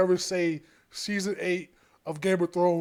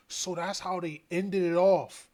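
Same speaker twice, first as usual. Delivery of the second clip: ordinary delivery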